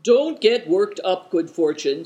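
ordinary delivery

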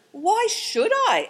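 put-on a boy horrified at taking off his shirt. A narrator's reading. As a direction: recoil in horror at the order.

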